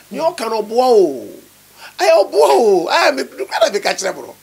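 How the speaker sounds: emphatic accusation. Real, not acted. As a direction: call out the lie, building in pitch and force. Exclaims that someone is lying.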